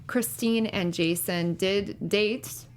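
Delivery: ordinary talk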